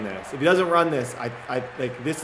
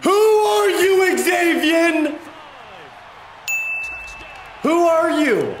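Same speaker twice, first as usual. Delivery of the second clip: high, loud, slow mocking yell; taunting shout; 14 semitones higher, 4 dB louder, much slower than usual. Real, not acted. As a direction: shout down the unknown player. Yelling at opposing player onscreen.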